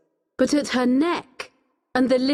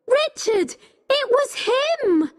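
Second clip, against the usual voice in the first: high-pitched accusing cry; urgent cry. A narrator's reading. Becky pleading with Richard, crying out urgently. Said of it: "Becky cried".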